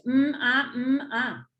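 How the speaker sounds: each sound "m", "a" said apart. Shown as a demonstration. Teacher shows children how to split mamá into sounds, slow and separate. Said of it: "analizo los componentes".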